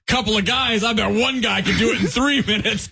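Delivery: mock incredulous brag, pitched high; scoffing boast. Acting out Steve's scoffing boast.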